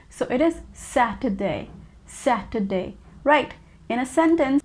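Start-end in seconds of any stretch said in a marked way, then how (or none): 0.7-3.0 SATURDAY said the British way, clearly set apart; British pronunciation. Shown as a demonstration. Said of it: "go with the British English".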